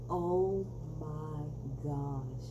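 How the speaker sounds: slow, stretched-out oh my gosh; overwhelmed and star-struck; drawn-out amazement; awed gasp. Real, not acted. Overcome by [name].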